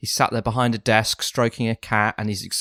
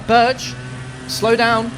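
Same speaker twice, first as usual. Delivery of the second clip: raised urgent call; shouting over noise; 12 semitones higher, much slower than usual. Acted. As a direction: shout him down over the engine. Shouting at lawnmower driver.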